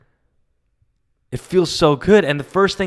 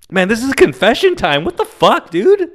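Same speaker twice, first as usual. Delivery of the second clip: rising higher and louder with each word; louder and higher excited yell; excited outburst; 10 semitones higher, 5 dB louder than usual. Real, not acted. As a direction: blurt it out, pitch climbing into a yelp. Excited outburst about confession.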